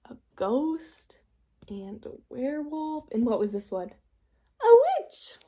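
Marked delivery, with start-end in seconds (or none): 4.6-5.4 much higher, louder playful witch voice; witchy squeak. Shown as a demonstration. Teacher naming the witch picture to the kids, high squeaky voice.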